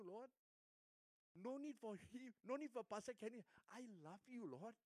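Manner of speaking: ordinary delivery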